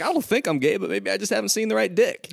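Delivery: quoting him up high; high mock voice. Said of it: "he's like".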